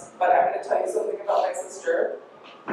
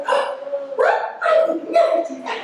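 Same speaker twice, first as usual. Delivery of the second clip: very high, mock-innocent denial; feigned innocence. Sister denying teasing to mom, exaggerated high innocence. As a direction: imitate a sister playing innocent, quick and high. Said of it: "She's like".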